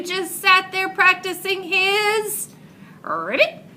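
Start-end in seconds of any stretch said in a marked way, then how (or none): none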